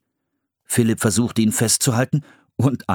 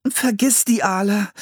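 chattering teeth; 12 semitones higher than usual. A narrator's reading. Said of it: "mit klappernden Zähnen".

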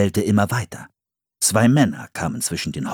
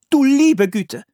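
high, louder exclamation of shock; exclaiming; 6 dB louder, slower than usual. Acted. A settler woman exclaiming at the trapped boy. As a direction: cry out at the sight of the boy. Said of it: "rief eine Frau".

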